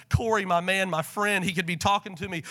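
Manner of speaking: ordinary delivery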